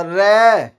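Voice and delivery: रे held long so its end vowel rings; drawn-out syllable. Shown as a demonstration. Teacher sounding out a letter for pupils, रे drawn out. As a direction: stretch the vowel of रे. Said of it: "रे को बोल के देखो थोड़ा सा".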